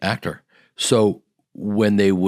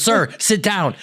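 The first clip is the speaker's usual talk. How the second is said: higher, firm sir sit down; stern waitress; 11 semitones higher than usual. Retold or imitated. Waitress orders him to sit, firm higher voice. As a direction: boss him back into his seat. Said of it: "She goes".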